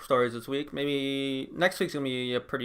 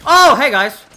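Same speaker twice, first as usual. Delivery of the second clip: loud greeting; 12 semitones higher, 16 dB louder, slower than usual. Real, not acted. Sudden shout during gameplay clip.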